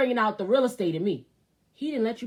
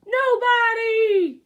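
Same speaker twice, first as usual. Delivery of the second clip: louder, high, slow "Nobody" call; loud high hype shout; 9 dB louder, much slower than usual. Acted. Hyping his own advice to viewers, high, loud and slow.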